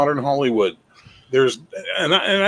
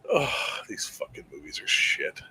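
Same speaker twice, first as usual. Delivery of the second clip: muttered aside; 8 dB quieter than usual. Real, not acted. Disgusted aside after movie argument.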